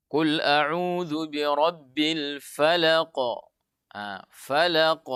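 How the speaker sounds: slow, drawn-out tajwid chant; Quran recitation. Shown as a demonstration. Teacher demonstrating recitation to students. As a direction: recite each syllable slowly. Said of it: "umpama kita membaca".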